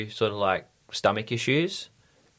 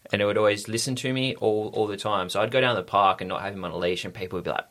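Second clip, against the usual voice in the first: ordinary talk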